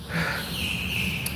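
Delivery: a long voiceless sigh out of sheer tiredness; long weary sigh. Retold or imitated. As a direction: let out a long, tired breath. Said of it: "I'm sighing because".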